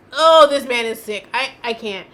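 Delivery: loud, appalled burst of disgust; real revulsion, loud then trailing off; disgusted outburst. Real, not acted. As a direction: blurt it out, sickened and loud. Reacting with disgust to testimony.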